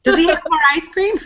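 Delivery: put-on high, playful kid question; playful child imitation. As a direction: imitate a kid moving straight on to treats. Voices the accepting grandchild's next thought.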